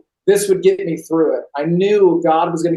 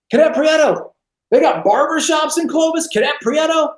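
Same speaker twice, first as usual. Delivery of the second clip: drill instructor shout; 9 semitones higher than usual. Retold or imitated. Drill instructor mocking the recruit's sideburns, high shouting bark. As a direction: yell it like a sneering drill instructor.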